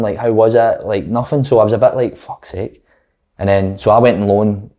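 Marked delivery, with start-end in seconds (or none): 2.2-2.8 very soft, voiceless retold curse; deflated mutter. Gutted player privately reacting to manager's indifference, deflated mutter. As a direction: mutter it low under the breath.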